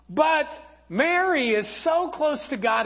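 usual speaking voice

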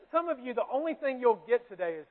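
ordinary talk, nothing marked

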